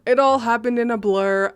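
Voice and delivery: ordinary delivery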